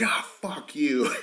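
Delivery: slow, exasperated retold curse; imitating pissed-off. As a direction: act out an angry cut-in, stretched out. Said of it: "C T was pissed".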